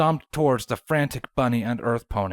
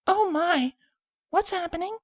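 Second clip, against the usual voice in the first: gentle high voice. A narrator's reading. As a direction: ask it gently and shyly. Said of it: "A gentle voice asked".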